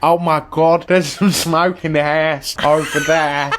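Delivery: hammed-up New Zealand accent slipping toward German; a bad New Zealand accent, half laughing; mock New Zealand accent. Quoting the farmers spotting smoke in a botched New Zealand accent. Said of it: "Do you know who I sound like?".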